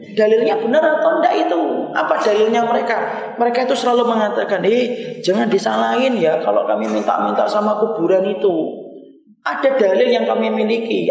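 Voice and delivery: high, loud, fast-driving sermon voice; full-force preaching. Acted. Preacher warning the congregation about shirk, loud and fervent.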